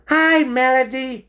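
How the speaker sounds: very high, loud hello; high, drawn-out character greeting; chirpy stoat. A narrator's reading.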